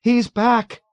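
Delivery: high, loud panicked cry; scream. A narrator's reading. Dunbar raising the alarm in the ward, screamed. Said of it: "Dunbar screamed again".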